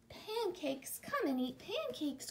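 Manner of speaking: lightly voiced high child elves; a small, high children's call; elf children crying out. A narrator's reading. Elf children invite Emily to eat, calling lightly. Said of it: "cried the elf children".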